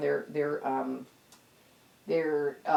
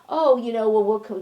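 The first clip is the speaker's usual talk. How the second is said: higher, louder mock voice; imitating the other side; 5 semitones higher, 6 dB louder than usual. Speaker mimicking people who keep coming back, higher mock voice. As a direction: mimic their casual excuse, pitched up. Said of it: "I still get this feeling like".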